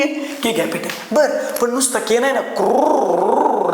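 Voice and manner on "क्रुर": क्रुर drawn out very slowly, letting the र sound through. Shown as a demonstration. Teacher sounding out क्रुर very slowly for his students. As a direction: stretch क्रुर out slowly so every sound is heard. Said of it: "रचा उच्चार आहे".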